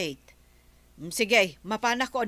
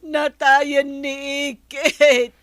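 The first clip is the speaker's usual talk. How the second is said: grave announcement; 4 semitones higher, 10 dB louder, slower than usual. Acted. Breaking news of death.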